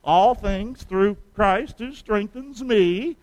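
slow unison verse recital; slow recitation. Shown as a demonstration. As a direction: recite it slowly so all can follow. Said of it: "Let's say it with me".

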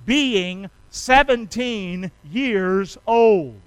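very slow, word by word; spaced out so a crowd can join in; say-along. Shown as a demonstration. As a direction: lead a congregation in saying it together. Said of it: "would you say this with me".

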